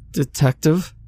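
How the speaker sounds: high rising question, scared yet hopeful; fearful hope. A narrator's reading. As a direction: call out scared but hoping. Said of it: "fear and hope mingling in her voice".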